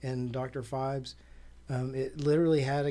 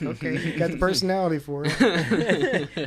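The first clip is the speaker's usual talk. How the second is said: laughing; 2 semitones higher, 8 dB louder than usual. Retold or imitated. Laughing quoted reply.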